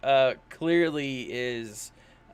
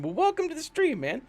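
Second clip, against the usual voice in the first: excited welcome; 13 semitones higher than usual. Real, not acted. Greeting a viewer excitedly.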